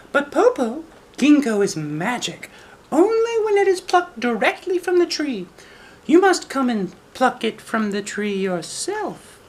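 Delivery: put-on high, sweet little girl voice. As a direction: speak as a clever little girl. Child voice in read story.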